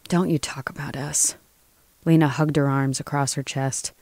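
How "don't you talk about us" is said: hushed, guarded retort; whispered warning. Acted. Lena warns the lobbyist off in a whisper.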